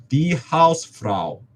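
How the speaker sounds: slow, emphatic "Die Hausfrau" with the weight on "Die"; stress on Die. Shown as a demonstration. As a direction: hit "Die" hard to correct the article. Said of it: "obviously a feminine thing".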